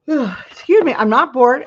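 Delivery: words stretched through a real yawn. Real, not acted. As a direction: speak through a yawn you can't stop. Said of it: "I'm just yawning".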